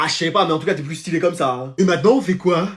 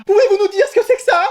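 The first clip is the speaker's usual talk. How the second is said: much higher, indignant demand; outraged; 12 semitones higher, 3 dB louder than usual. Acted. Mother confronting her son about his report card. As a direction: demand an answer in shocked outrage.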